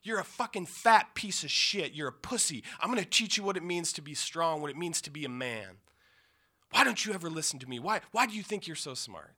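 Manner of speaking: half-acted furious stepfather yelling insults; retold screaming. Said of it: "he's screaming what, the things he always screamed".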